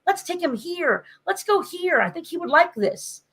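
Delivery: high, excited little voice; excited kid's voice; eager kid. Retold or imitated. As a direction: bubble over with plans like an eager kid. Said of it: "He's like".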